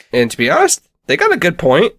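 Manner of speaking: ordinary delivery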